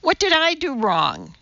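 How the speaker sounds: put-on high, worried little girl; slightly alarmed. A narrator's reading. Judy asks teacher what she did, alarmed. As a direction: plead innocence in a child's voice. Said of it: "slightly alarmed".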